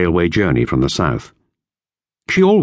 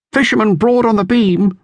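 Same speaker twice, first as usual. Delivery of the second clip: high, loud sighting call; lookout calling; 3 dB louder than usual. A narrator's reading. Lookout reporting a fishing boat to the captain, loud call. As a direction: sing out from the bows like a real lookout. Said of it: "said the lookout".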